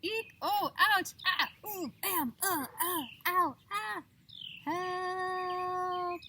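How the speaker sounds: high, loud yelps and cries as he tumbles; farmer's yelps of pain. Acted. Tumbling farmer cries out high and loud in pain. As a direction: squeal each bump down the hill. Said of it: "he cried".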